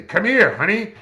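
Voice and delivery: "Come here, honey" loud and high, swooping up on "honey"; urgent calling inflection. Shown as a demonstration. Lecturer demonstrates a different connotation, loud high call. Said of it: "change the meaning of a sentence by how you inflect it".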